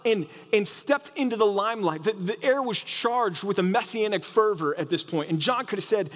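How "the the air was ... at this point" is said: normal talking voice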